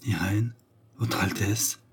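soft, halting astonishment; stammering. A narrator's reading. Malcolm suddenly seeing the grand duchess, softly stammering. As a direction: break it into small, faltering pieces. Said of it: "balbutia-t-il".

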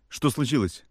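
sudden alarmed high cry; startled cry. A narrator's reading. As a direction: blurt it out in alarm. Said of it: "вскрикнул я".

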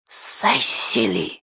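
sharp whispered hiss, drawn-out sibilants. A narrator's reading. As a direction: hiss the name as a furious hushed warning. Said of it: "прошипела её тётка". (гневным шипящим шёпотом)

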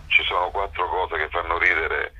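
normal talking voice

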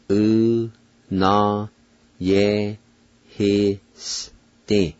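"U, na, ye, hi, s, ti" in isolated, held syllables; syllable-by-syllable pronunciation. Shown as a demonstration. Teacher pronounces Cherokee word for terror, "U, na, ye, hi, s, ti" slowly syllable by syllable. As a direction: sound out "U, na, ye, hi, s, ti" very slowly, pause after each. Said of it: "now I'll pronounce them".

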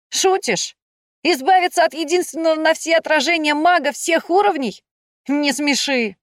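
high-pitched, incredulous brush-off; dismissive. A narrator's reading. Shefer waves away Iv's worry, dismissive. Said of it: "отмахнулся от меня Шефер".

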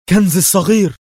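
high, loud exclamation of amazement; astonished gasp. A narrator's reading. Levi discovering his coins in the bag, astonished. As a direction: burst out in astonished delight. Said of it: "وهو يطلق شهقة دهشة ويقول".

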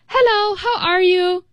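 bright, high, cheerful hello; happy greeting. Shown as a demonstration. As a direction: greet them like you're glad to see them. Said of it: "then I sound pretty happy".

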